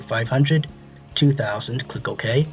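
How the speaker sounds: ordinary delivery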